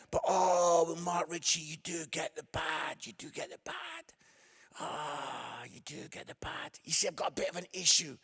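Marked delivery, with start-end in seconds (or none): 1.6-6.8 ominous fading. Acted. Repeated ominous line, fading.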